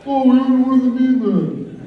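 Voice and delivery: put-on squeaky sassy girlfriend take; girlfriend impression. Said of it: "I do that impression of my girlfriend".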